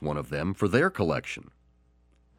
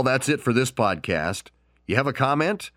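ordinary delivery, nothing marked

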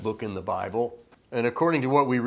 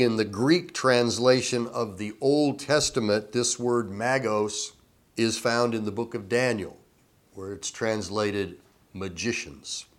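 ordinary delivery

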